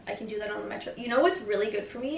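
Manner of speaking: normal talking voice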